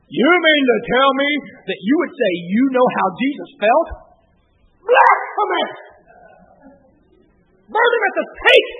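starts indignant, ends high and loud; mock outrage rising to a shout. Cranky church brother outraged at the preacher, building to a shriek. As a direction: build from scolding to a shrieking accusation.

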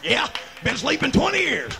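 teasing holler. Acted. Preacher teasing a dozing churchgoer, teasing holler. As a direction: call it out at the sleepers. Answers his own congregation joke, teasing.

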